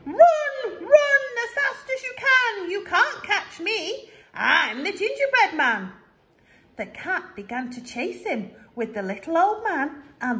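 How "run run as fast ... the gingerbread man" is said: high, loud, teasing taunt; squeaky cheeky runaway; gingerbread man voice. Acted. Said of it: "He called to the cat".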